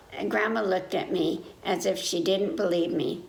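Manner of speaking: usual speaking voice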